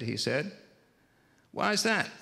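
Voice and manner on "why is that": put-on pitched up high, kindly asking a child on the phone; caring phone operator. Operator checks on the lonely boy, high and kindly. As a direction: lift into a gentle operator voice. Operator voiced in a retold story.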